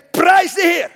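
loud, high cry of praise; preacher's shout. Real, not acted. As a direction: shout it out to the whole room. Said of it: "De komende twee weken".

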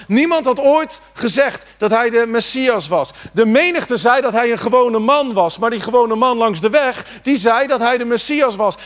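fervent preaching. Acted. Preacher rousing the church about the blind man, fervent. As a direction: hammer it home to the congregation.

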